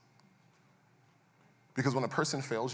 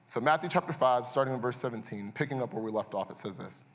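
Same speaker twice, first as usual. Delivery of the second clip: usual speaking voice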